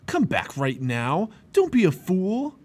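forceful order, slow then high and loud; stubborn boy. A narrator's reading.

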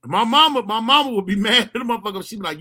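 usual speaking voice

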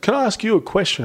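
lightly voiced pushy charity guy; mock pushy question. Charity guy outside Coles, mock eager question. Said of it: "this guy's like".

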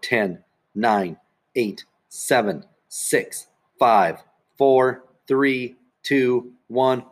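plain reading voice

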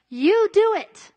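sudden loud, slow, emphatic command; commanding shout. Retold or imitated. Jesus challenging worried disciples with a loud, slow command. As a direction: throw the challenge back at them, full voice.

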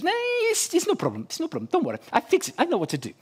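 lightly voiced Polish builder reassuring; a hint of Polish builder saying don't worry; Polish accent. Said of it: "he's got a very strange accent".

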